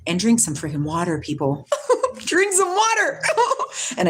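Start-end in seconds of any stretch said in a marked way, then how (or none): 1.9-3.3 high, laughing repeat. Real, not acted. Teasing her viewers about drinking water, laughing high. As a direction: giggle it out high.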